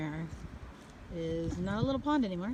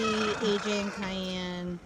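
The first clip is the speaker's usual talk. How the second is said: names drawn out slowly; much slower than usual. Real, not acted. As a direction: stretch each name out.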